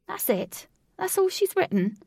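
high, loud disbelieving questions; bewildered. A narrator's reading. Alec looks at the short message, bewildered. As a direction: climb in pitch with disbelief.

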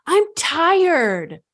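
put-on high, loud whiny child voice; whiny kid. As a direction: whine like a grumpy little boy who won't get up. Voicing son refusing to dress.

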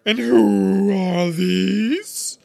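slow, very loud, high commanding queen; imperious queen. Acted. Queen turning on Alice, slow, loud and imperious. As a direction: loom over the child and demand.

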